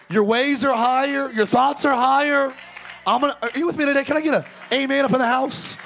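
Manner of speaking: high, loud revival preaching; preacher rousing the church to an amen; full-force preaching. Acted.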